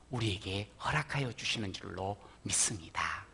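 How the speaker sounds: soft whispered prayerful close; whispered blessing. Real, not acted. Pastor blessing the congregation, whispered.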